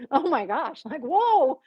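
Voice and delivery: gasp, then high-pitched amazed exclamations; retold astonishment. Reacting to the thunderous voice, stunned amazement. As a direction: gasp and let the pitch leap up. Retelling awe at the voice.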